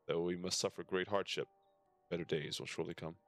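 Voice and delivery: ordinary talk, nothing marked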